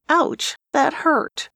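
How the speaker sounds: high, slow, hammed-up wounded voice; mock hurt. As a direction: play the injured heart for laughs. Jess hand over heart, mock hurt.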